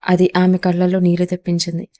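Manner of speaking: usual speaking voice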